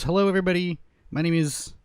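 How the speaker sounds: hammed-up channel intro; intro host voice. Shown as a demonstration. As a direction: open the show like a hype host. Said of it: "It goes".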